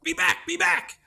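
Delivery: very high, squeaky shooing; tiny creature squeaking the party back; high squeaky crayfish shooing the adventurer away. Acted. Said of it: "he's like".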